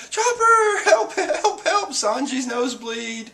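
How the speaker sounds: high-pitched call for help; hammed-up panic, starting very high and easing down; mock panicked cry. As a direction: act out a frantic plea for the doctor.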